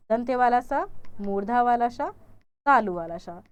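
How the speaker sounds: loud, careful "दंते वाला स, मूर्धा वाला स, तालु वाला स"; teacher sounding out the three sibilants; three s sounds demonstrated. Shown as a demonstration. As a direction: say each "स" clearly by its place in the mouth. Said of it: "इसका उच्चारण आप तालु से करते हैं".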